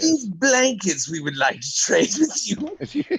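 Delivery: slow, sly and overly sweet; mock colonist. Mock colonist offering smallpox blankets.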